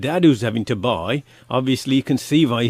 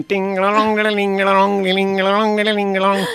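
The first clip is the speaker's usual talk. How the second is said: higher, monotone looping jingle; imitated van chime; 4 semitones higher than usual. Ice cream man pulls up outside houses, imitated chime. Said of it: "I play it again".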